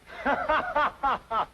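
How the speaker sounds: high burst of laughter. Acted. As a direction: let out a big, high guffaw.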